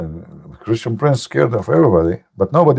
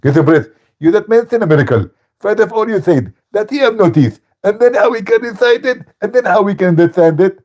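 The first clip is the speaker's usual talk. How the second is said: higher, hammed-up mock lecturer; imitating Zakir Naik; 8 semitones higher than usual. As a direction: parody the opponent's answer. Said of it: "Zakir Naik".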